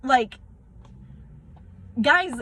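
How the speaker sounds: fighting back real tears as she pleads; close to tears. Real, not acted. Pleading with her viewers about the mandates, near tears. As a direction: voice climbing high and cracking. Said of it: "I'm gonna cry 'cause this is so messed up".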